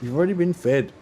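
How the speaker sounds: high-pitched scolding of a pet; scolding the cat. Real, not acted. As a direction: tell the cat off, high and fed up.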